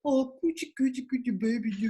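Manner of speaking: light mock baby talk to an infant. Mocking friends who talk to kids this way, light baby talk. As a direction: coo at it like a doting parent, lightly. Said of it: "they talk to their kids like".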